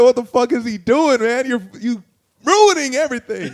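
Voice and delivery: high, loud retold complaint; acted-out dismay, building to a laughing wail; exasperated outburst. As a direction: cry out as if the night is being wrecked. Acting out exasperation at Jeff's scene.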